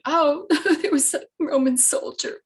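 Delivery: high, loud mock wail with laughter; mock-tearful laughing. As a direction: blurt it through a hammed-up sob and giggle. Retold tearful answer, laughing at herself.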